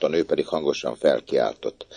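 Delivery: ordinary delivery, nothing marked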